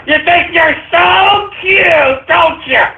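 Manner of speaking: high, drawn-out shouted taunt; angry taunting. Real, not acted. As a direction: yell it slowly at the caller, high-pitched. Host rages at prank caller.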